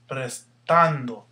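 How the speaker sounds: "Prestando" slowly, with "tan" punched loud; slow teaching demo of "Prestando", stressed "tan"; stress on middle syllable. Shown as a demonstration. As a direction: draw out "Prestando", leaning on "tan". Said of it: "Here's your stress".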